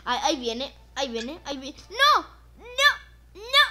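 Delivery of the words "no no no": high loud repeated cries; panicked no. Real, not acted. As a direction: panic as the shark comes. Shark coming.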